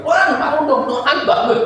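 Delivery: high, loud begging to wake up; hammed-up panic, pleading for help; frantic plea. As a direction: shout it like a desperate disciple. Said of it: "Mereka udah, udah pontang-panting".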